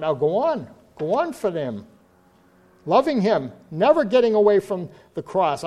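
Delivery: loud, urgent preaching to the sinners in the room; fervent altar call. Acted. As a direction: press the call home. Altar call at full force.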